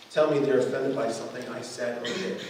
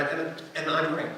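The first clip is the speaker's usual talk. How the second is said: usual speaking voice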